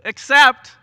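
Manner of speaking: except shot out high and loud; a sharp emphatic except; stress on except. Real, not acted. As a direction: hit except hard and high. Emphatic interruption.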